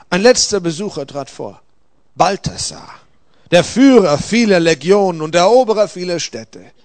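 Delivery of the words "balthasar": plain reading voice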